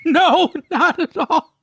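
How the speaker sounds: high, slow laugh through words. Real, not acted. Laughing agreement about bully.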